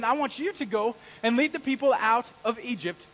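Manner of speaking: loud, high commanding voice; God's loud command. Retold or imitated. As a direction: send him off with authority. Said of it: "and saying".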